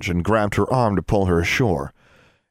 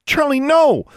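high, urgent cry of warning; shout; 6 dB louder than usual. A narrator's reading. Girl stopping her raven's attack, shouting. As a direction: yell to stop the bird. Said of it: "Alexandra shouted".

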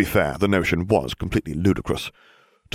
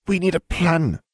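put-on high, insistent girl's voice; higher-pitched firm insistence; 11 semitones higher than usual. A narrator's reading. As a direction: press the point, pitched well up. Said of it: "she insisted".

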